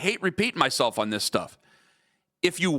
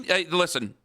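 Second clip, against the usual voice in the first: ordinary delivery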